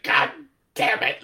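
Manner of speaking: mock yell. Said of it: "this time he's like".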